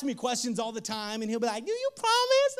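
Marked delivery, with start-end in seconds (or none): 1.6-2.6 put-on high little-kid voice asking; toddler voice. Dad mimics his toddler son asking, in a high kid voice. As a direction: pitch way up like his two-year-old son.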